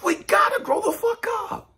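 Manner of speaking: high, loud angry outburst; shouting. Real, not acted. Preacher shouts down his critics, loud and angry. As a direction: let it blow up, loud and high.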